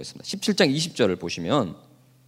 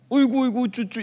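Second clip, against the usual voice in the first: put-on higher cooing baby talk; doting parent; 6 semitones higher than usual. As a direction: coo over the spoiled youngest. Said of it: "이래요".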